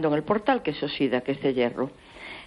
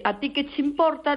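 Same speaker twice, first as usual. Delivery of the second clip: jumps much higher on "¿A ti qué te importa?"; mock curt retort; 10 semitones higher than usual. Caller mimicking neighbor, high mock retort.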